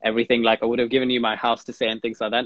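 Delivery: ordinary talk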